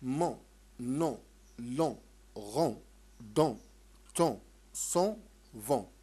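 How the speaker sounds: "مون" "نون" "لون" with a faint نون, said loud; light n drill. Shown as a demonstration. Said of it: "النون خفيفة". (تكرار بنون خفيفة لا تظهر)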